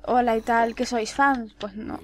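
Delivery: normal talking voice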